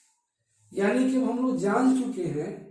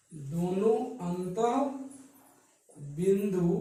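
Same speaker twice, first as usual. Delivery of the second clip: phrase by phrase with long pauses; slow dictation; slower than usual. Shown as a demonstration. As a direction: dictate it bit by bit for note-taking. Dictating a definition for writing.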